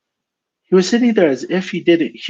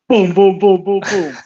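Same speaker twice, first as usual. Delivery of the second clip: high, punchy run of booms; evenly hammered mock booms; imitated pounding; 5 semitones higher, 4 dB louder than usual. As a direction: mimic heavy knocks on a door.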